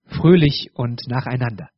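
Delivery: ordinary talk, nothing marked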